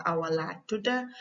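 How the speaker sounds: Gambian Wolof short reply, "tuda" ending in a; Gambian accent. Shown as a demonstration. Teacher showing the shorter reply, Gambian accent. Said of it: "tudu and tuda is just accent".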